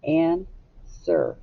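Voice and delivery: "Answer" split into an and ser with a gap; syllable by syllable. Shown as a demonstration. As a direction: break "Answer" in two, an... ser. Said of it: "How many syllables?".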